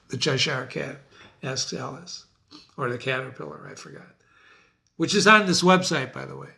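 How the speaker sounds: normal talking voice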